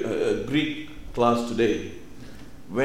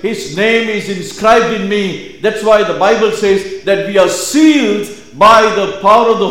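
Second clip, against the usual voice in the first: loud, higher-pitched preaching at full force; 7 semitones higher, 10 dB louder than usual. Acted. Preacher declaring God's dream for you, impassioned preaching. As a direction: proclaim it to the congregation with rising fervour.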